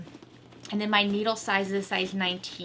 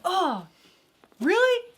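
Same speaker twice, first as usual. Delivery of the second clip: incredulous; 8 semitones higher, 4 dB louder, slower than usual. Retold or imitated. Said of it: "You're like".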